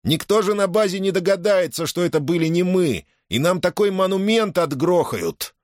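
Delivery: high, gleeful cry; ecstatic shout. A narrator's reading. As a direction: crow it out with joy. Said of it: "восторженно завопил". (восторженно)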